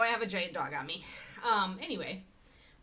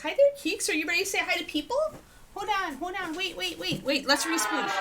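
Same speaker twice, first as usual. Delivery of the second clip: much higher, cooing at the dog; dog-talk voice; 11 semitones higher, 6 dB louder than usual. Real, not acted. Talking to her dog.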